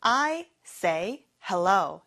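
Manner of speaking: very slow, every word clear; slow model sentence. Shown as a demonstration. Teacher showing students the sentence, very slow. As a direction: lay each word down for the class. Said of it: "같이 해볼까요?".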